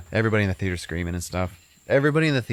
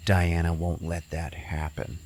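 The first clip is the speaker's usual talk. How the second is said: ominous warning; 2 semitones lower, slower than usual. Retold or imitated. Boy warns of ghost, ominous.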